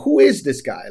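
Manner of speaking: ordinary talk, nothing marked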